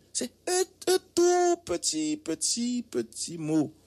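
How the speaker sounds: high drawn-out "tout petit, petit, petit mot"; tiny word stressed. Acted. Host stressing how small the word is, high and drawn out. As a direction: stretch "petit" as if shrinking it. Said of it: "repeats tiny word bit".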